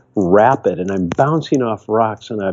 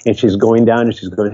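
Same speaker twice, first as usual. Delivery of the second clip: ordinary talk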